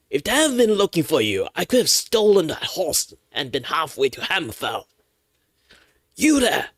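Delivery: high, loud whiny thief; horse thief voice. Acted. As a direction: complain bitterly like a cornered thief. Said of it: "let's do the horse thief".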